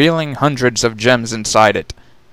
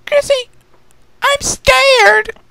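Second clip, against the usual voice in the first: very high, loud frightened squeal; high-pitched childlike fear; scared child; 4 dB louder, much slower than usual. Acted. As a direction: cry out like a frightened little girl. Frightened child during the quake.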